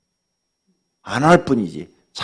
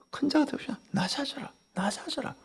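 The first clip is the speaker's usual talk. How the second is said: 1 voicing the Lord; 13 semitones higher, 10 dB quieter than usual. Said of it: "주님이 그랬잖아요".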